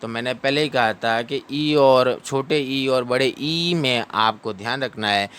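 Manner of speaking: ordinary talk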